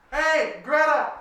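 high, loud, drawn-out hail; yelling down. Acted. Tang hails Greta in the boat from above, yelling. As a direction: yell down to someone in a boat. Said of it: "Tang yells down".

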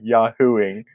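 Yahooing drawn out slowly for teasing; mocking Yahooing. Teasing a co-host about his pronunciation, mocking drawn-out Yahooing. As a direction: stretch Yahooing into a playful imitation. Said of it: "You literally said it like".

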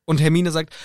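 usual speaking voice

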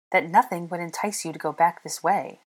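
ordinary delivery

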